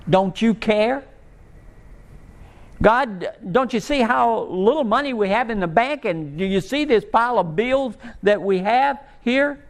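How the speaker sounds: lightly voiced desperate plea to God; pleading prayer. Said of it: "we ask that a thousand different ways".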